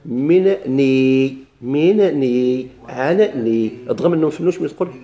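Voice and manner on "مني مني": مني stretched very slowly, the nun held; teacher sounding out مني; drawn-out mini. Shown as a demonstration. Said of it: "قل مني".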